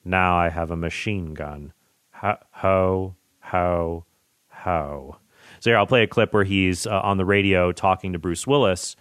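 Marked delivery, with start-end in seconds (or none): 0.0-5.2 a hint of a smug Hans Gruber reading the note. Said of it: "and Gruber reads it".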